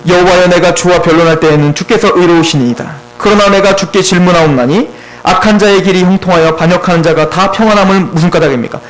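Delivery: low, measured Bible reading; scripture reading. Acted. Preacher reads Jeremiah's question to the congregation, low scripture reading.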